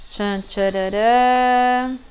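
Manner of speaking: slow "tchan, tchararã" with a long held last note; sung ta-da fanfare. Pretend. Teacher drawing a new word, mock fanfare. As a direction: imitate a suspense jingle before a reveal. Drum-roll before revealing a word.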